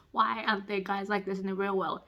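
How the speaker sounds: ordinary talk, nothing marked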